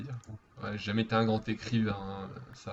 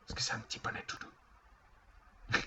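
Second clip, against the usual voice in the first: slow, soft whispery cooing; 10 dB quieter, slower than usual. Pretend. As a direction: coo gently as if to a little animal. Cooing at a pony. (tout doux, lentement chuchoté)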